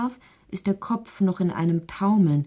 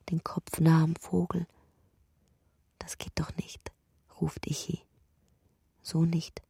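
whispered narration; 4 semitones lower than usual. A narrator's reading. Narrator telling of Ichi curled in his burrow, in a hushed whisper. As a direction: whisper it like a secret told in the dark.

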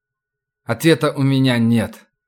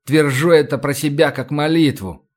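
plain reading voice

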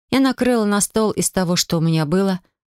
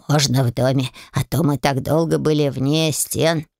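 low, slow and modest; lightly voiced house spirits; 3 semitones lower than usual. A narrator's reading. House spirits choosing to dine indoors, low and slow. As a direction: spirits asking politely.

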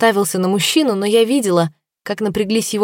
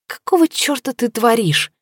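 higher, indignant inner voice; 6 semitones higher than usual. A narrator's reading. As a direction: scold yourself in outrage. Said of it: "возмущалась логика".

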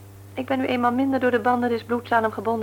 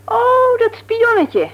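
1 scornful recognition; 13 semitones higher, 6 dB louder, much slower than usual. Acted. Wife reacts scornfully to name.

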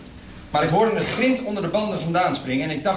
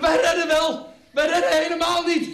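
outraged; 10 semitones higher than usual. Acted. Outraged retort from the floor.

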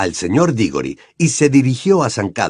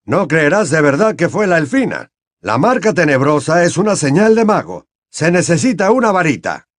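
higher, reasoning colleague; lightly voiced Mr Weasley; 6 semitones higher, 3 dB louder than usual. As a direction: Mr Weasley reasoning with Diggory about the wand.

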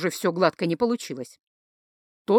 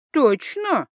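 suspicious; 5 semitones higher than usual. Acted. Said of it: "подозрительно".

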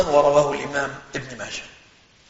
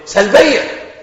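mock annoyed teacher, much higher; angry teacher; 7 semitones higher, 4 dB louder, much slower than usual. Teacher answering the student's question, irritated. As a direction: snap the word back like an irritated teacher. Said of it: "فبص لي كده بغضب".

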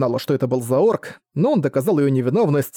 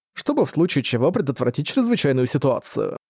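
ordinary delivery

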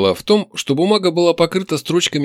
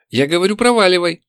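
higher, insistent demand; raised voice; 7 semitones higher than usual. A narrator's reading. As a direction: raise the voice to send someone away. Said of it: "повысила она голос". (повысив голос)

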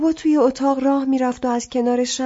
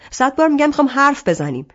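«حرف بزنیم» said louder and fast, angry; exasperated shout; 3 semitones higher, 6 dB louder, faster than usual. Acted. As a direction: hammer it home, fed up. Arsalan repeats, exasperated, loud.

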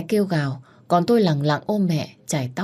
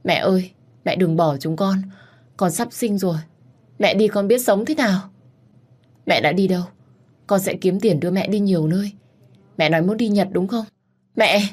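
desperate plea; 4 semitones higher than usual. Acted. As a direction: beg her not to leave, voice rising. Daughter pleads at deathbed. (giọng van xin cao và to, nghẹn ngào)

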